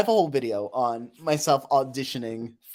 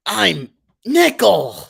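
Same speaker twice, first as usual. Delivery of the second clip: loud, drawn-out, higher cartoon voice; Nickel; 7 semitones higher, 10 dB louder, much slower than usual. Streamer showing chat a Nickel impression, loud drawn-out voice. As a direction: belt it out slowly in character. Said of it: "That's my Nickel impression".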